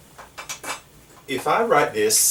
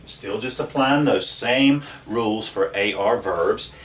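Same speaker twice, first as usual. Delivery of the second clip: ordinary delivery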